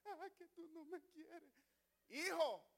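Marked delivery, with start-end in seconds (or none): 0.0-1.5 soft, whimpering mock complaint of an old mother; hushed, teary mock whine; whiny old parent. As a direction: whine like a weepy old parent who feels unloved. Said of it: "Por nada se pone a llorar".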